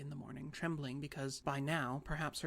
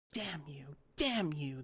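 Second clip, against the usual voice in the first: put-on a furious old lady yelling, second curse louder; screaming; 6 dB louder, much slower than usual. A narrator's reading. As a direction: snap the curses at her. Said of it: "the little lady screamed".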